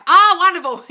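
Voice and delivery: loud, high gush of delight. Real, not acted. Coach cheers a viewer's affirmation, loudly delighted. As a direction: burst out with high, loud approval.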